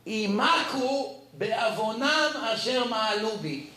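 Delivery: קריאה חגיגית של פסוק; verse recitation. Acted. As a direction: proclaim the verse like scripture. Reads verse from the Chumash.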